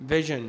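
"vision" ending in a voiced zhen; sion as zhen. Shown as a demonstration. Teacher repeating the example word, "vision" with zhen. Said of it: "ينطقوا جن إذا سبقوا بحرف متحرك".

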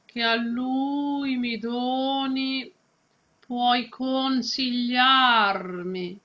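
slow, the U in "lui" held long; long vowels. Shown as a demonstration. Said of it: "also this U is quite long".

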